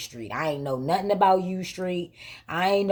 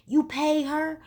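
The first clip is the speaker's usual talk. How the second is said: put-on shocked boyfriend; 10 semitones higher, slower than usual.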